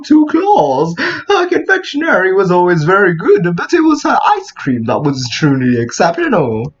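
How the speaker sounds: theatrical storytelling with wandering pitch; put-on character voice. Player voicing the game character's backstory, theatrical put-on voice. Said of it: "jumping between a lot of different tones".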